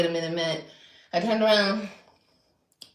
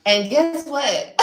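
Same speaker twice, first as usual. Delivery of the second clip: drawn-out, louder teasing buildup; slow loud tease; 6 semitones higher, 8 dB louder, much slower than usual. Real, not acted. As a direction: stretch it out loud to tease the reveal.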